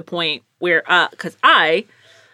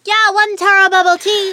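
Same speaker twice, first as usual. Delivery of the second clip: very high put-on barista voice; high, sing-out order repeat; barista's voice; 11 semitones higher, 4 dB louder than usual. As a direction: imitate a barista with a startlingly high voice. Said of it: "that's actually how you sound".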